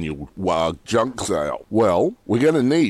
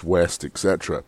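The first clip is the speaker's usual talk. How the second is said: ordinary delivery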